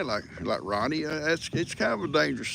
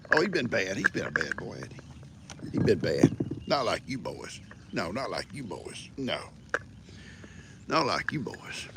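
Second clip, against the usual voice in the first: cooing to animals; 7 semitones lower, 7 dB quieter than usual. Real, not acted. Man sweet-talking his donkeys, soft and low. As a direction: croon gently at the animals.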